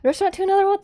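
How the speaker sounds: high questioning title; surprised realization. Real, not acted. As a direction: lights up with recognition. Sudden recognition of title.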